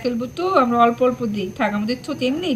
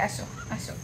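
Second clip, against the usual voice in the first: soft breathy whisper, coaxing; hushed beckoning whisper; whispered call; 15 dB quieter than usual. Real, not acted. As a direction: whisper come here, come here. Calling the child over softly.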